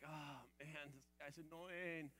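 a touch of reluctant annoyance; grumbling. Retold or imitated. Disciple grumbling about fetching the beggar, soft mock grumble. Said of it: "be like".

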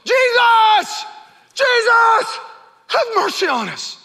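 high, loud cry for mercy; desperate yell. Retold or imitated. Lepers crying out to Jesus, loud desperate shout. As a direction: shout it out to him like your life depends on it. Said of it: "Someone yelled at him".

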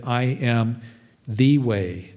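"the" said as thee, leaning on it; stress on THE. Shown as a demonstration. Preacher to the congregation, leaning hard on "the". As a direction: press on "the" in "the way". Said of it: "definite article".

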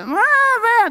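put-on very high childish bawling; mock tantrum wail. Kids throwing a fit on the ground, mock tantrum wail. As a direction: imitate a flailing child's wail. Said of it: "The whining, the fit".

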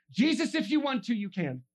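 high, loud declaration with falling end; statement of faith. Shown as a demonstration. Leper to Jesus without begging, confident declaration. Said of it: "Just a statement of faith, not begging".

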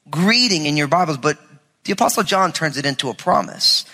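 normal talking voice

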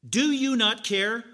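loud, slow demanding question; accusing demand. Retold or imitated. Martha confronting the merciful Lord, loud and slow. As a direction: press the accusation. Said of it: "she's saying to the merciful".

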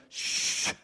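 an unvoiced mocking shush. Crowd hushes the blind beggar, mock shush. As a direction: hush him like an annoyed crowd. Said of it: "they kept telling him, like".

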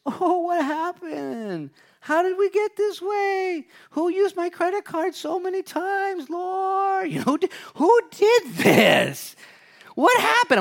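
very high, drawn-out comic wail; hammed-up bewailing, loud at the end; mock whining. Preacher mimics people lamenting their finances to the Lord, mock whining. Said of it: "kind of whine a little about it and sing the blues".

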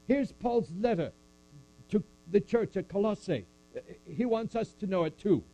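high, eager letter announcement; excited church reader. Retold or imitated. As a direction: present the letter with eager pride. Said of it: "taking it to the next church".

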